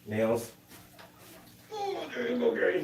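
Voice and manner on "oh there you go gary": pet talk. Real, not acted. Talking to guinea pig Gary.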